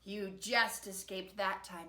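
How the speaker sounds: ordinary delivery, nothing marked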